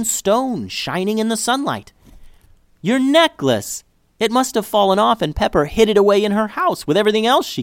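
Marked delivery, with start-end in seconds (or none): 2.8-7.6 high, loud, thrilled cry; excited shout. Acted. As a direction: call out the good news. Child shouts discovery.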